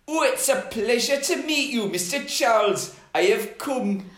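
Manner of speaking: high, lilting Geordie voice; broad Geordie, pitched well up; Geordie velociraptor. Acted. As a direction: play a polite Geordie dinosaur. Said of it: "he might be a Geordie Velociraptor".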